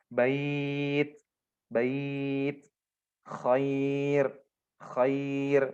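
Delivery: bait and khair each drawn out on ai; mad lin examples. Shown as a demonstration. Teacher drilling examples for students, slow. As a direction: stretch each word then stop. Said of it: "Nah, kayak gitu".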